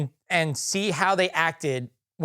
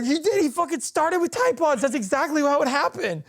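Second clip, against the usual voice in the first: mock insistent; 9 semitones higher than usual. Mock insistent agreement, much higher.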